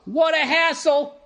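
drawn-out, high-pitched scoffing; exaggerated slow ad-copy exclamation; mocking ad read. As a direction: stretch it out high, making fun of the copy.

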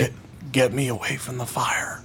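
faint feminine voice. Acted. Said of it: "a very feminine voice".

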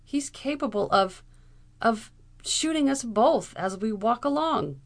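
falter, then blurt out the fear; imploring. A narrator's reading. Frightened man warning his companion about the captain, imploring. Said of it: "implored Frank".

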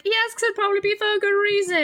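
very high, fast mock-prim answer; high-pitched know-it-all imitation; prim Hermione. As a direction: chirp it back quick and prissy. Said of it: "And Hermione's like".

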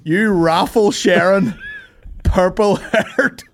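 high, loud, slow hammed-up insult; mock rant. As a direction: spit out each insult, loudly. Mock roasting online haters.

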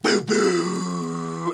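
loud mouth-made punch impact; imitated impact boom. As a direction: voice the boom of the blow landing. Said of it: "and then it goes".

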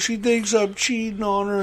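high, slow mock-tearful whine; imitated crying. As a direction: act out a man sobbing his complaint. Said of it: "and he's crying".

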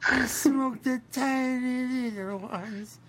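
prissy high-pitched boast; mock poser voice. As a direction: put on a snooty little show-off voice. Mocking a cigar-smoking poser.

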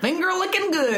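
put-on goofing on a Kentucky accent; mock Kentucky accent. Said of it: "Could you do a Kentucky accent for me?".

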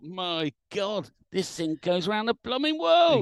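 high, loud retold amazement; amazed. Young radio ham marvels at his first distant contact, excited.

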